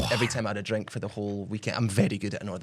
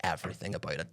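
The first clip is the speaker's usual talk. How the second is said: mock hushed mate; 4 semitones lower, 8 dB quieter than usual. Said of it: "he goes up to me and goes".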